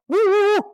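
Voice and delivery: high, loud imitated dog woof. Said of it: "the dog is like".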